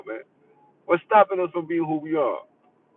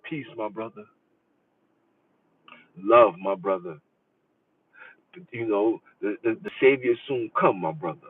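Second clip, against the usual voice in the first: mock pious brother; 7 semitones lower than usual. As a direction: play the sanctimonious brother.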